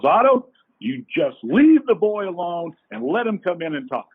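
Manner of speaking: retold firm, high-pitched telling-off; scolding wife. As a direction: play the wife ordering her husband to back off. Wife scolding her husband in story.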